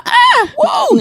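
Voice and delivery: put-on high whooping drunk yelp; rowdy drunk. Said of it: "I'm a drunk".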